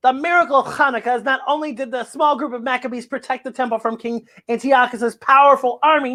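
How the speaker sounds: plain reading voice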